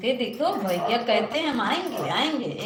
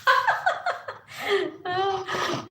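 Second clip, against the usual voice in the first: high squealing laugh with a crying edge; laughing over a guest who is crying; 10 semitones higher than usual. Real, not acted. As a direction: burst out in a high teary laugh. Teasing a crying live guest.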